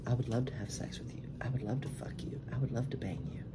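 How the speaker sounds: soft breathy whisper of the better line; hushed, intimate whisper; whispered demo. Shown as a demonstration. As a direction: whisper it like a secret to him. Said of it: "just say".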